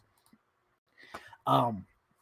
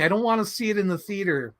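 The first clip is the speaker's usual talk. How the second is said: ordinary delivery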